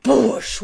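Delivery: sudden loud "boosh"; explosion noise. Shown as a demonstration. Speaker showing listeners energies mixing and exploding, loud explosion noise. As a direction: burst out a booming explosion sound.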